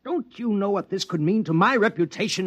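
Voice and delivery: husband berates his wife over the shutter, sharply scolding. Acted.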